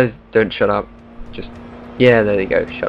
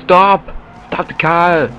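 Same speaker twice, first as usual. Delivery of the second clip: loud, higher-pitched command; mock shouting; 6 semitones higher, 5 dB louder, slower than usual. Player ordering the driver to stop the car, mock shouting. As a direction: yell it as a play order to halt.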